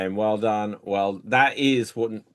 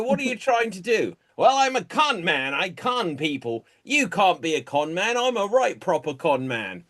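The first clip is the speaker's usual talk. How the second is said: high-pitched, cheeky British swindlers bickering; hammed-up con artists; 10 semitones higher, 3 dB louder than usual. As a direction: play scheming con men in a much higher, animated voice.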